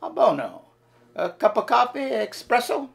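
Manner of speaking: a hammed-up Italian accent, serving with gusto; Luigi Italian accent. Waiter Luigi welcoming diners in an Italian accent. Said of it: "Listen and learn".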